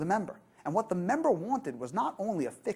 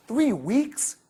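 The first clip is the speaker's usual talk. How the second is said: matching intensity; 5 semitones higher, 4 dB louder than usual. Shown as a demonstration. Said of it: "how you respond is".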